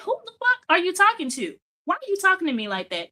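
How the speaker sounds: high-pitched indignant comeback; mock confrontational challenge; defensive cursing. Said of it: "a thing of protection, a defense mechanism".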